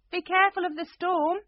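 high, loud warning call; alarmed queen calling out, pitched right up. A narrator's reading. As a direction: call down urgently to someone below.

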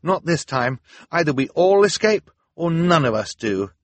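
resolute, emphatic; firm. A narrator's reading. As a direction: lay down the terms with force. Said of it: "Klaus said firmly".

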